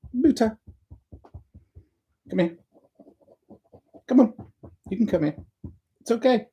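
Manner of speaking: high, sweet pet talk to the cat; coaxing the cat. Real, not acted. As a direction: coax the cat over gently.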